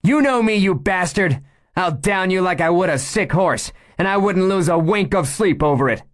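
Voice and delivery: high, loud, hard threat; fierce threat. Acted. Meg threatening the gunman over her gun, fierce and loud. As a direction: bite off each word at him.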